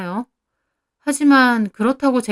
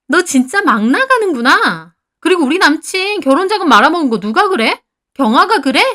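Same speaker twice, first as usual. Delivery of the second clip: loud, higher, slowed anger; the friend snaps back after being insulted, louder and higher; furious retort; 7 semitones higher, 6 dB louder than usual. Acted. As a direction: bite back at her, outraged. Friend furious in acted quarrel.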